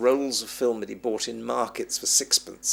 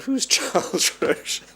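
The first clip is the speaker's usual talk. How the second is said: high, loud laughing puzzlement; puzzled laugh; 12 semitones higher, 6 dB louder than usual. Retold or imitated. Recalling his bafflement at the cameraman's call, laughing high. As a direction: laugh through the name in surprise.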